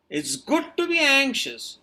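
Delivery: ordinary delivery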